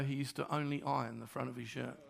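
softly quoting the widow's words; quiet retold quote.

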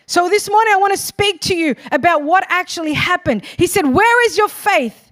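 loud, high, fervent preaching; preaching at full force. Acted. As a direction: build it up and drive it home to the church.

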